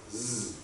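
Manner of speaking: Z as a buzzing zzz; Z sounded, not named; letter sound Z. Shown as a demonstration. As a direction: buzz Z as a bare consonant. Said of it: "Underline it and say Z".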